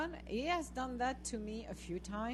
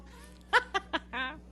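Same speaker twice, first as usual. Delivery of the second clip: higher, bubbling laugh; gleeful laugh; 6 semitones higher, 3 dB louder than usual. Real, not acted. Woman telling viewers she told them so, gleeful laugh. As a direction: laugh at being proved right.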